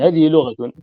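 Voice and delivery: teacher firmly stresses it is a dialect; emphatic point. Real, not acted. Teacher stresses point after throat.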